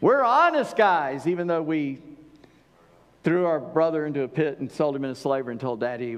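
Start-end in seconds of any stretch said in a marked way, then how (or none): 0.0-1.2 higher, louder, slow sarcastic honest; mock honest. Preacher mocking the brothers' claim, sarcastic. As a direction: sneer the word honest with a big fake innocence.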